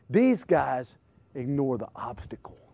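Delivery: ordinary talk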